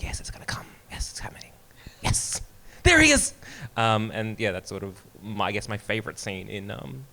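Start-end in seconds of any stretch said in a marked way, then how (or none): 0.0-2.4 a soft, breathless whisper of anticipation; excited whisper. Retold or imitated. Fan whispers while waiting for Han in the cantina, eager. 2.8-3.3 a sudden higher, louder burst of delight; thrilled. Retold or imitated. Fan sees Han Solo appear at last, thrilled outburst.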